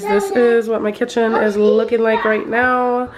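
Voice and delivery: ordinary talk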